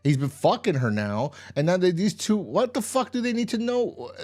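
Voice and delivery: high-pitched, indignant rant; exasperated. Real, not acted. Viewer ranting about a character's senseless choice, exasperated. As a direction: demand answers, voice climbing high in disbelief.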